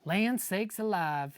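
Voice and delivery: ordinary delivery, nothing marked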